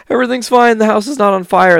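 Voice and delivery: sing-song pretend all is well; fake calm denial. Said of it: "And they're just like".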